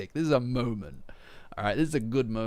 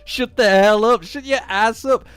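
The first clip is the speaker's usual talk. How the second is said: angry yell; 8 semitones higher, 12 dB louder than usual. Real, not acted. Yelling at anime villain.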